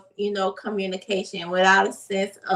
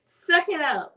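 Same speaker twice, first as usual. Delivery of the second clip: higher, mock scolding of a crying kid; dismissive parent; 6 semitones higher than usual. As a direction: play the impatient parent who won't allow tears. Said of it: "We don't have to be like".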